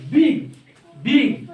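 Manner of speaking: BIG (बिग) as one firm beat; one-beat demonstration. Shown as a demonstration. Teacher showing students one syllable, single firm beat on बिग. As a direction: say बिग in a single burst, pause after. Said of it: "एक बार ओठ खोल के".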